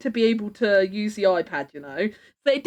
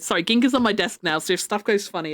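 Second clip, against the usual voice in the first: usual speaking voice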